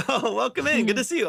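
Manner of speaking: high, bright welcome after a laugh; excited greeting. Real, not acted. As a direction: greet them with delight.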